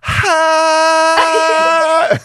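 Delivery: loud high held "haa"; sustained high wail, comically off-key; prolonged flat note. Retold or imitated. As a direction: hold one long, slightly flat note. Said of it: "prolonged, like, slightly flat note".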